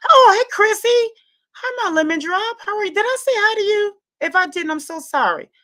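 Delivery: high, bright squealed hello; gushing hi to a friend; excited greeting. Real, not acted. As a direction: greet a favourite viewer with delight.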